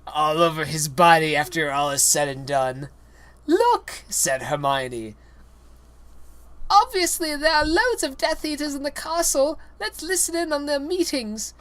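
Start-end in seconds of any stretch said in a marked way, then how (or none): 3.5-4.0 pitched way up and louder; bossy schoolgirl call; high Hermione voice. Acted. Reader voices Hermione calling to the boys, high. 6.7-11.5 pitched way up and louder; bossy schoolgirl call; high Hermione voice. Acted. Reader voices Hermione calling to the boys, high.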